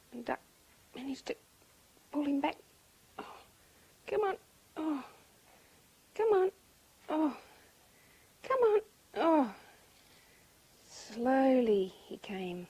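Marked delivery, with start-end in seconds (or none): 3.2-9.5 effortful urging broken by groans; acted strain, grunting "oh". A narrator's reading. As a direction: heave a heavy friend along between words. Duck heaving rooster along.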